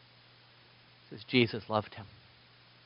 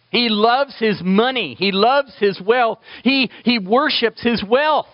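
higher, emphatic preacher's repetition; impassioned preaching; 7 semitones higher than usual. Real, not acted. Preacher exposing the rich man's idolatry, impassioned. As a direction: drive each accusation home.

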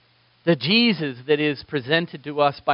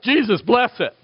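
mock casual blessing, a touch higher; flippant prayer; 5 semitones higher, 3 dB louder than usual. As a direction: toss off a lazy prayer. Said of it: "we're like".

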